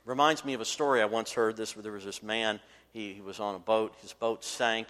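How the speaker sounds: ordinary talk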